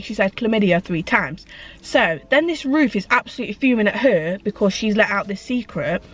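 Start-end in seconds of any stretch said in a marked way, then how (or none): none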